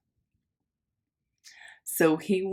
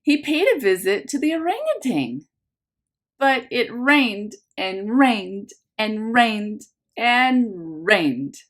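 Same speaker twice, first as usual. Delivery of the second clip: bright storybook read-aloud, a little louder; lively picture-book narration for a class; reading to children; 3 semitones higher, 4 dB louder, slower than usual. A narrator's reading. Teacher reads picture book aloud.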